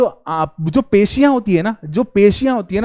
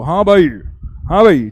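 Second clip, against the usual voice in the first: put-on old man reading; 2 semitones lower than usual. Shown as a demonstration. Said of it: "मैं चश्मे लगा के थोड़ी सी एक्टिंग कर लूँ".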